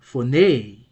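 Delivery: "phoneme" said fo-NAY with a strong high-then-falling ending; accent on last syllable. Shown as a demonstration. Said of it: "pronounced with a higher tone".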